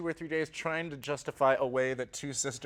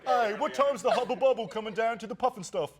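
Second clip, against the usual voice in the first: higher mock British accent; mimicked British accent; 5 semitones higher than usual. Co-star teasing his impression, mock British accent. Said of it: "That's what you do".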